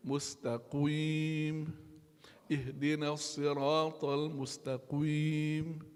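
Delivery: slow "Mustaqim" with a rounded "qwim"; drawn out, rounded faulty "mustaqim"; mispronounced mustaqim. Shown as a demonstration. Teacher imitating the student's rounded mistake. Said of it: "would be incorrect".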